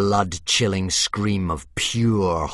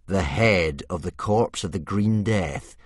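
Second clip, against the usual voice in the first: plain reading voice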